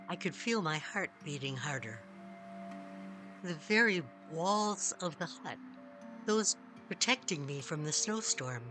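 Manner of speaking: ordinary delivery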